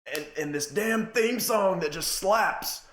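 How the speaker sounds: put-on a kid torn between hate and hype; kid's mock outrage. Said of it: "I was like".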